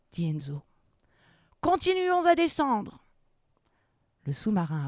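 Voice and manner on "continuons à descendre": high, slow, raised-voice order; captain's command. Acted. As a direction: order the crew onward. (ordre lent de capitaine)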